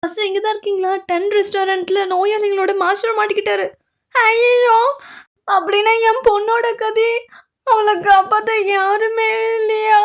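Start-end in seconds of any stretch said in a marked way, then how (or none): 4.2-10.0 high, loud tearful lament; anguished wail. Acted. Parent crying for a trapped daughter, anguished wail. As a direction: wail it out, dragging the last words.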